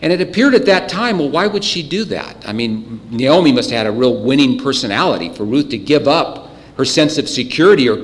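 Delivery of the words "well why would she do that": ordinary talk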